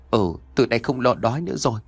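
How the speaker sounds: ordinary delivery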